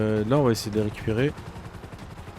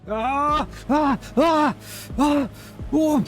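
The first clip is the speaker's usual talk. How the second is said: loud, high screams of pain; 8 dB louder, much slower than usual. Acted. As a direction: scream out in agony. Crashed, in pain.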